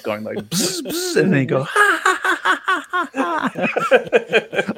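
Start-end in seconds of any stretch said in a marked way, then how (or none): 0.4-1.2 high buzzing saw imitation; chainsaw noise. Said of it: "a Texas Chainsaw thing". 1.6-4.7 high horror-movie villain cackle; maniacal laugh. Pretend. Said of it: "a Texas Chainsaw thing".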